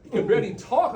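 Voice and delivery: high, loud and slowed; emphatic and animated; excited. Real, not acted. Excited cotton mouth explanation.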